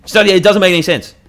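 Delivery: high, loud exasperation; loud, high-pitched disbelief; exasperated rant. Real, not acted. Ranting about online arguments.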